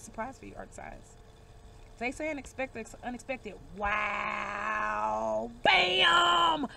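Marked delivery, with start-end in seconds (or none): 3.8-6.7 long drawn-out wow into a booming bam; excited exclamation. Real, not acted. Reader reacting to an unexpected card, loudly thrilled. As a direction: stretch it out, then burst into a shout.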